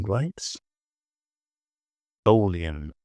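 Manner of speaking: ordinary talk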